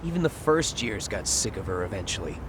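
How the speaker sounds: ordinary talk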